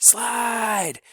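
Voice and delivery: held, piercing repeat of the cry; repeated scream. A narrator's reading. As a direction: stretch the scream out longer. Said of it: "screamed Clebo".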